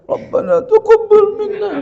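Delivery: loud, high-pitched mock praying; imitated tearful prayer. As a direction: wail the prayer like an over-emotional worshipper. Mimics uncomprehending worshipper's tearful prayer.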